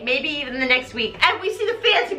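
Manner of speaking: ordinary delivery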